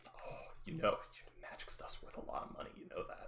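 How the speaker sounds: sly tempting half-whisper; devil on your shoulder. Pretend. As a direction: whisper it softly, coaxing like a little devil. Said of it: "devil on your shoulder".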